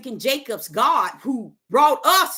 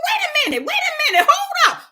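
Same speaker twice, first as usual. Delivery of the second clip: much higher, louder protesting shout; protesting to God, high and shouting; alarmed shout; 8 semitones higher, 4 dB louder than usual. Pretend. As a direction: cry out wait in alarm.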